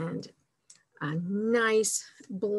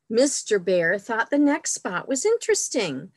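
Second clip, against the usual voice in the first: animated picture-book narration, a bit louder than chat; storytime reading; 4 dB louder than usual. A narrator's reading. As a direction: read it out expressively for little listeners. Reads picture book to children.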